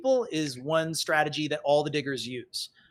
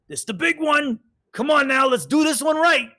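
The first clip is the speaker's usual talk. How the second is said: high, loud, pumped-up rallying call; hyped-up crew; 9 semitones higher, 6 dB louder than usual. Acted. Crew psyching up for the charge, high and loud. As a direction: get the crew fired up for the blast.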